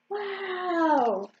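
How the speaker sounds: drawn-out wow of wonder; amazed. A narrator's reading. Duckling sees own reflection, amazed. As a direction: marvel at it, stretching the word.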